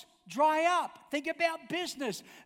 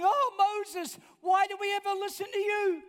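complaining crowd; 8 semitones higher than usual. Acted. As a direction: throw the grumbling mob's whine at Moses. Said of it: "And now they're complaining".

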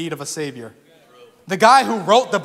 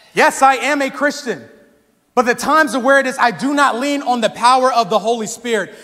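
raised, emphatic sermon voice; impassioned preaching; 4 semitones higher, 4 dB louder than usual. Real, not acted. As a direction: drive each point home to the congregation.

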